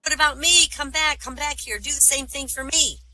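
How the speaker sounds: high-pitched calling snake voice; pleading snake. Acted. Snake left behind by the hopping rabbit, high pleading call. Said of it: "says the snake".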